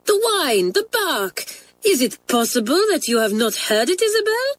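high, incredulous French mistress; impatient. A narrator's reading. French teacher pressing a pupil about the dog, impatient. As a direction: pitch it way up, exasperated. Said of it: "said Mamselle impatiently".

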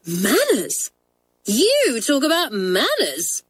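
high, drawn-out indignant retort; raging. A narrator's reading. Janet cutting in on a schoolmate, raging. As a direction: throw it back slowly, with outraged emphasis. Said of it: "raged Janet".

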